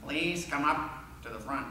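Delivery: measured imitation of a gym teacher; lightly voiced formal summons; distinctive teacher voice. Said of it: "how he used to say that".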